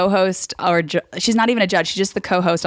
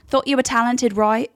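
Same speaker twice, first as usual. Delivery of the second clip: high, passive-aggressive British sympathy; fake-kind dig with a tag question; shady British TV host, pitched up; petty British co-host; 6 semitones higher than usual. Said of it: "question on the end that is the biggest shade".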